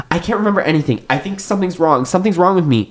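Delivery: high, thin anxious voice; hysterical. A narrator's reading. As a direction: fret in a high voice. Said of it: "She's hysterical".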